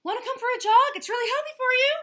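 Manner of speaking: high, loud invitation; loud pitch. Acted. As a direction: call it out enthusiastically. Hippo calling out.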